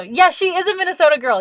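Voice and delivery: high, eager yes; excited agreement. Real, not acted. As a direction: burst out agreeing. Answering chat eagerly.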